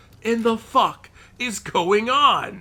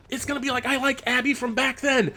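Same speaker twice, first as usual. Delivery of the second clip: ordinary delivery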